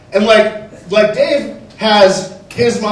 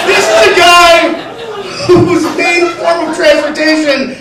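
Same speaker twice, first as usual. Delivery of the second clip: high, loud, gloating yell; triumphant shouting; 9 semitones higher, 5 dB louder than usual. Real, not acted. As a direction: crow over your crazy victory. Triumphant comic rant.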